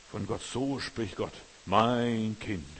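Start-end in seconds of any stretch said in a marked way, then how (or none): 1.7-2.7 louder, resonant divine address; booming God voice. Shown as a demonstration. Preacher doing God addressing his child, loud and solemn. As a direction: declare it like a voice from heaven. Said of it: "Nein, Gott spricht nicht".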